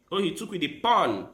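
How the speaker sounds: usual speaking voice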